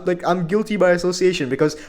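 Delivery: ordinary delivery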